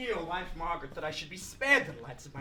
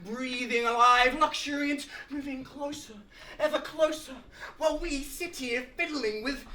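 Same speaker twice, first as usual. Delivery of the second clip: raised pitch, swelling rapture; rapturous oration; 5 semitones higher, 5 dB louder than usual. Acted. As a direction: get carried away by the grandeur, higher and louder. Lecturer carried away by his subject.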